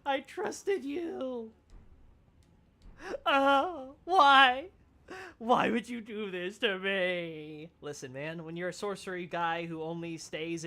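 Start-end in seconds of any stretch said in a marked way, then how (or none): 0.1-7.7 mock anguish. Mock heartbroken at Fia.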